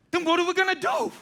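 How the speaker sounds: high, loud and hurried; exasperated high-pitched outburst; mock alarmed question. Mock protest at what was said.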